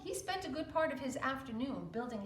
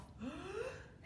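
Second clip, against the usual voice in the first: sound; 9 semitones higher, 7 dB quieter than usual. Acted. Wolf drawing breath.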